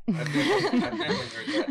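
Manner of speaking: high, loud laughing disbelief. Real, not acted. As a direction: squeal in laughing shock. Mock disbelief at coffee claim.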